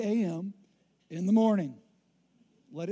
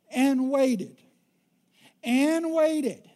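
each waited louder and higher; building emphasis; 4 semitones higher, 6 dB louder, much slower than usual. Acted. Building the wife's long wait.

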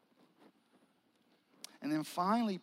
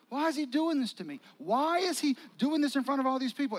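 higher, louder and pained; hurt Peter asking why in front of everyone; 7 semitones higher, 6 dB louder than usual. Acted. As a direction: protest like a wounded friend. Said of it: "Peter was hurt".

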